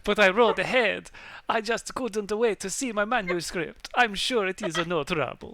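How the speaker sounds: big put-on character voice; Lawrence. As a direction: ham it up as a loud, drawn-out lord. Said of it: "do you wanna take over Lawrence".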